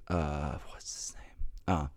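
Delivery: quiet unvoiced whisper to self; whispered aside. Real, not acted. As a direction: whisper while trying to recall. Searching for a name.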